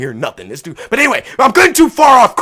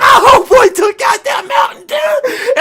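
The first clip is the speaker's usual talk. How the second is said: high, loud pleading cheer; excited hope; 13 semitones higher, 4 dB louder than usual. Retold or imitated. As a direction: shout it like you're begging for the win. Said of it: "I was like".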